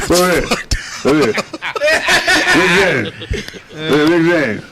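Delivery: imitating Charlie Murphy. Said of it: "Charlie don't talk with his teeth".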